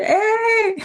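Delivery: high-pitched "eh!" amid laughter; drawn-out high mock cry; playful yell. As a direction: let out a high, laughing yelp.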